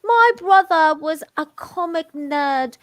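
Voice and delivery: high, slow British reading voice; put-on British accent. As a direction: read it in an exaggerated British accent. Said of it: "gonna hate my British accent".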